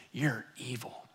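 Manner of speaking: soft, low, understated accusation; quiet accusation. Retold or imitated. Crumpled boy looking up at the man who screamed, quiet mock accusation. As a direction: say it small and hushed, imitating a hurt child.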